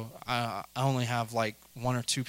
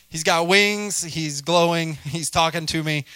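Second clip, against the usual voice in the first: higher, louder, mock-panicked rush; louder and higher, in mock alarm; freaking out; 4 semitones higher, 6 dB louder than usual. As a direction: blurt it out like you can't believe your eyes. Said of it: "I would be freaking out".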